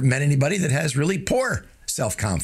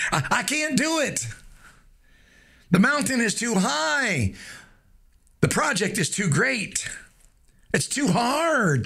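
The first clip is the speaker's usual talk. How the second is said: whiny self-doubt voice; 7 semitones higher, slower than usual. Pretend. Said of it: "catch yourself saying things like".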